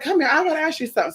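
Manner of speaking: high, fast beckoning; luring voice. Retold or imitated. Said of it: "he said".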